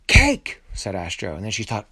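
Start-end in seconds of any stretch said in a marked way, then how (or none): 0.1-0.6 sudden high, loud call; loud, high-pitched holler. A narrator's reading. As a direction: shout it up to someone out of reach. Astro calls out to the cake.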